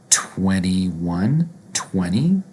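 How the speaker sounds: "twenty" said as "twenny", no second T, rising; twenties with N. Shown as a demonstration. Teacher drilling the twenties, N sound. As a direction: lift the end of "twenty", N in place of T. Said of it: "all of the twenties will pronounce with an N".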